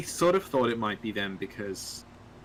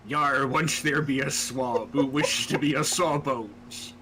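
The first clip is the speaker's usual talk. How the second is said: slow pirate talk; 3 dB louder, slower than usual. Pretend. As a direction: growl it like an old sea dog. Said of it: "Could you improvise it?".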